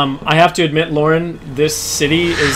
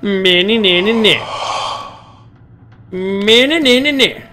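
sing-song nah nah taunt, higher; mocking taunt; 4 semitones higher, slower than usual. Real, not acted. Taunting the monster from safety.

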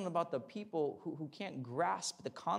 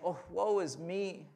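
mock self-pity; 4 semitones higher, 4 dB louder than usual. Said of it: "where he is saying".

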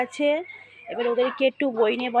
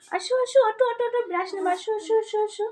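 coaxing; 8 semitones higher, 4 dB quieter, faster than usual. Real, not acted. Coaxing child to come brush.

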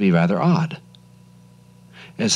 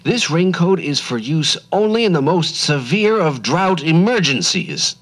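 put-on stern old man; 9 semitones higher than usual. Ackerman explains the coat.

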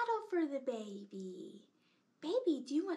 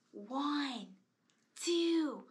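ordinary talk, nothing marked

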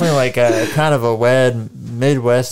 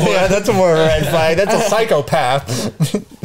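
laughing, higher and slow at the end; 6 semitones higher than usual. Real, not acted. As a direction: chuckle through it. Laughing at the example.

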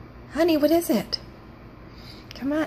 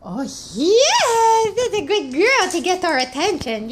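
delighted; 5 semitones higher than usual. Real, not acted. Dog finally yawns on cue.

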